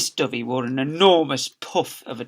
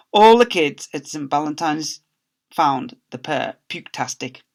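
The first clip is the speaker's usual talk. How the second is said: ordinary delivery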